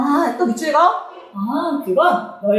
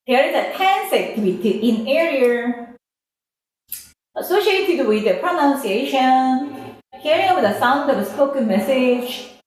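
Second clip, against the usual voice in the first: reading English sentence slowly aloud; teacher reading the textbook line slowly to class; slow text reading; much slower than usual. Shown as a demonstration. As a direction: read each word out slowly, like dictation.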